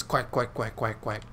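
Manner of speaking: low, soft duck quacks; mocking quack. Gamer showing how all you guys sound, mocking quacks. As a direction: quack at them in a low, quiet voice. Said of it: "All of you guys sound like this".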